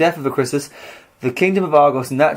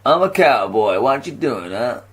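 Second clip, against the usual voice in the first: put-on cowboy accent; 2 semitones lower than usual. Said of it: "that's more like New York".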